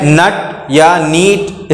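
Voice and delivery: नट with uh, नीट with long ee; wrong pronunciations. Shown as a demonstration. Teacher lists what not to read, mispronounced नट या नीट. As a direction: contrast नट and नीट as misreadings. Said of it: "इसको नहीं पढ़ते".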